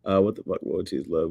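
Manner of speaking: ordinary talk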